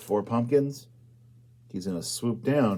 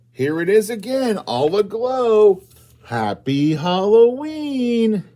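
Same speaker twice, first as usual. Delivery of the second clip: higher, slow and drawn out; slow sing-song finale; 9 semitones higher, 6 dB louder, slower than usual. Acted. Closing the book for the children, slow glowing finale. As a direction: savour the ending for the kids.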